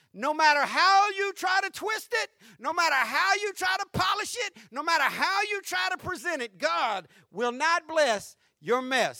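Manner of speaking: shouted, high preaching that eases off; preaching crescendo. Acted. Pastor shouting a warning to his congregation, high. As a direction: build the list and hammer "how".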